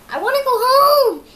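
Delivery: high, loud desperate plea; panicked cry. A narrator's reading. Dog racing down the mountain crying out, high and loud. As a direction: beg to go home while fleeing. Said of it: "he panted".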